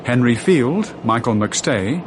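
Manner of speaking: ordinary talk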